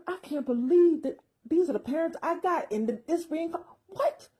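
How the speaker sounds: put-on high-pitched, mildly incredulous baby's reaction; mock disbelief. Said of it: "with a look like".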